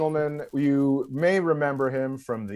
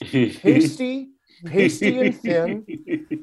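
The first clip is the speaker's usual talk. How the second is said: higher, slow, dramatic telling; mock storyteller voice; 4 semitones higher, much slower than usual. Kid on a podcast recalling the man, slow and high. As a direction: spin it like a spooky tale.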